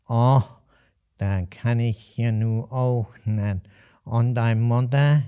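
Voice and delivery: put-on old innkeeper's drawn-out Hessian drawl; slow nasal Alsfeld dialect. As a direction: stretch each word slowly through the nose. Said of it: "nasale Aussprache und ganz langsam".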